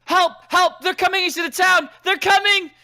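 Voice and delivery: high, loud, frantic yelling; panicked cry for help. Acted. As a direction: scream for help as a terrified townsperson. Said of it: "you guys hear someone go".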